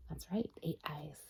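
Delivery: soft whispered aside; hushed aside. Real, not acted. Aside to child.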